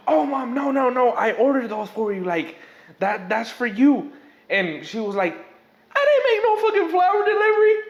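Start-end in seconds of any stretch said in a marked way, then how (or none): 0.1-4.1 urgent daughter. Retold or imitated. Daughter voiced in story. 5.9-7.7 much higher, louder, fast shriek of denial; shrieking mom. Retold or imitated. Mom yelling at her daughter, high loud shriek.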